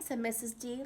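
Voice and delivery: ordinary delivery, nothing marked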